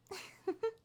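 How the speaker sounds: short, high, light laugh; little high-pitched chuckle. Acted. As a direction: giggle lightly to yourself. Amused giggle while explaining herself.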